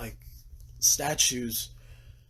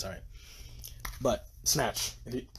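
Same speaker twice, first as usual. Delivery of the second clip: ordinary delivery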